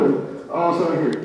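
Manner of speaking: normal talking voice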